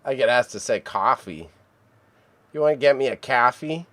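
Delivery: normal talking voice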